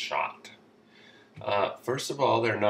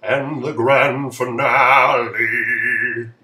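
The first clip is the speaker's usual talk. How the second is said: loud, drawn-out showman's announcement; hammed-up ring announcer flourish; grand announcer; 10 dB louder, much slower than usual. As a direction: boom it out with long wavering vowels. Announcing the last question.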